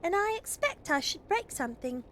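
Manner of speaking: put-on high little-girl voice, mildly unenthusiastic; unemotional. A narrator's reading. Phyllis answers Father about driving engines, unenthusiastic. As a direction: pitch it up, a reluctant child. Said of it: "said Phyllis in an unemotional tones".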